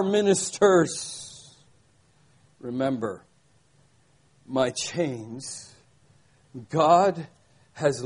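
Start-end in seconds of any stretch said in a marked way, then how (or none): 2.6-5.7 much lower and softer, almost confiding; hushed refrain. Acted. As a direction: let the words sink low and soft. Refrain repeated, dropped soft.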